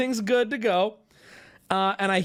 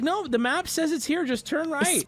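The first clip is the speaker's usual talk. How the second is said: insistent; 9 semitones higher than usual. Retold or imitated. Acts out insistent friend navigating.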